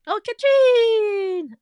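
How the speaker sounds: high, loud, drawn-out greeting; excited call. Real, not acted. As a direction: call the name out with delight. Greeting a viewer, calling out.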